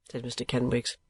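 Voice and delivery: plain reading voice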